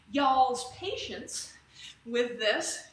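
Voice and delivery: slow, playful Southern drawl on "y'all's patience"; Southern accent. Pretend. Instructor thanking her class in a mock Southern accent. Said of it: "got that southern accent all of a sudden".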